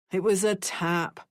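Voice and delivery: usual speaking voice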